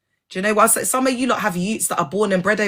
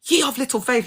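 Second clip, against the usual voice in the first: lightly mocked preacher, pitched up; imitating preachers; 6 semitones higher than usual. Speaker recalling church preaching, mild preacher imitation. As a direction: pitch it up like a scolding sermon line. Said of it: "like, preaching and then they'll be like".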